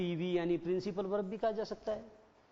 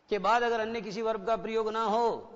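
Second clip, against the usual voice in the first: normal talking voice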